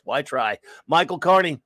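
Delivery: ordinary delivery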